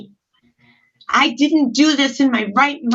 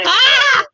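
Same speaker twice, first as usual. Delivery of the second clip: pained cry; 10 semitones higher, 4 dB louder than usual. Real, not acted. Cutting herself in a struggle.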